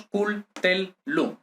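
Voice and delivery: "cul, tel, lum" counted out in three syllables. Shown as a demonstration. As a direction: separate cul, tel, lum with small gaps. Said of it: "tres sílabas".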